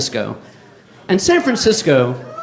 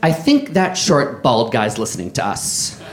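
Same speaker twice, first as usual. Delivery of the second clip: slow, lower, mock-conspiratorial imitation; overheard woman's voice; 5 semitones lower, 3 dB quieter, slower than usual. Comic quoting a woman about the bald guy, slow lower mock voice. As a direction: drag it out, dropping lower at the end. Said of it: "This is what I overheard her say".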